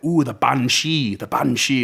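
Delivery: lightly imitated Irish accent, friend's voice. Irish friend telling the narrator about the Banshee in an Irish accent. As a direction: quote an Irish friend in a hint of his accent. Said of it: "he had this thick accent".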